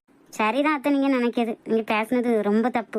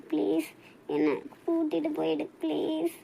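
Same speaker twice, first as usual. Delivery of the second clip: tearful plea; 2 semitones higher, 7 dB quieter than usual. Acted. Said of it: "அழாதடி".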